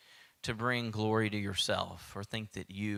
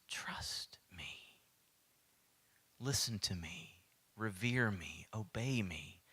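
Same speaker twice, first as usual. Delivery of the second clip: much softer, unvoiced "Trust me"; whisper; 4 semitones lower, 8 dB quieter, slower than usual. God to the anxious listener, "Trust me" whispered. Repeated plea.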